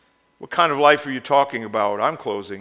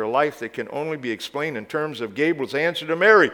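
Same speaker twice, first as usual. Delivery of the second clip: normal talking voice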